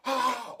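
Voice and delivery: loud imitated yelp of pain; mock pain cry. Feeling hot spatula on arm, loud mock yelp. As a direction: cry out as if suddenly burned.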